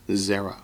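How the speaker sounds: zera with weight on ZE; ZE-ra, first syllable stressed. Shown as a demonstration. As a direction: say zera as ZEH-rah, stress up front. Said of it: "the accent's on the first syllable".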